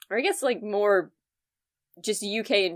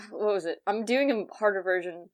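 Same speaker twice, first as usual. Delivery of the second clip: ordinary delivery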